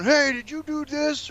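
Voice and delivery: high, slow imitation of a teen calling upstairs; mild mock yell. Guest retells a teenager yelling upstairs in a mild mock yell. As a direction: lightly call it up the stairs. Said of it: "the one was yelling upstairs".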